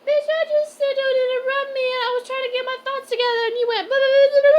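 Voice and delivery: high, loud exasperated tirade; shrieking scold; mock furious rant. Real, not acted. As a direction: tear into her for interrupting. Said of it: "Sorry, I'm chatty today".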